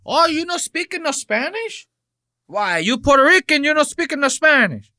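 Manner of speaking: high, exaggerated Spanish-accented broken English. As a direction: ham up a Spanish accent, mocking.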